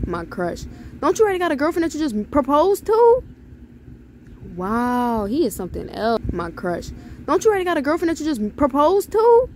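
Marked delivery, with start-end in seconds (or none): 1.0-3.2 high, loud indignant question; incredulous outburst. Real, not acted. Indignant reply to a game message. 7.3-9.5 high, loud indignant question; incredulous outburst. Real, not acted. Same indignant reply, repeated.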